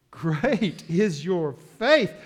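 P=A; slow proclamation. Acted. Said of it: "And so he says to her".

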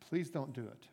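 a soft, earnest plea to the master; begging. Acted. Servant on his knees begging the master, soft plea. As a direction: beg him not to do it, quietly. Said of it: "he begged the master".

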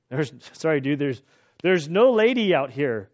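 usual speaking voice

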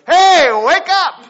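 mock wake-up shout. A stranger yelling at a corpse at a funeral, loud mock shout. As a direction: bellow it at the coffin like a lunatic. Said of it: "somebody walks up to the coffin and goes".

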